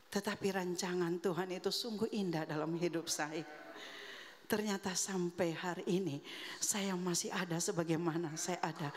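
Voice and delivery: usual speaking voice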